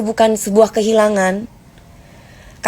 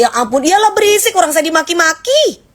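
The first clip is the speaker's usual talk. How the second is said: exasperated; 7 semitones higher than usual. Real, not acted. Exasperated at being insulted.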